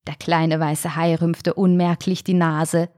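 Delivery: plain reading voice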